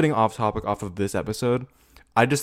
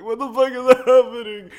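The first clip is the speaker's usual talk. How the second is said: much higher, louder mock-panicked shriek; panicking mother; 14 semitones higher, 8 dB louder than usual. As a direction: play the mum losing it. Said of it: "Her mother's panicking".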